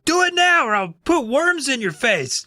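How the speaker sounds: put-on high, exasperated kid's demand; hammed-up ultimatum, starting very high; mock threat. Todd threatening Regina with worms, mock threat. Acted escalating threat to his sister.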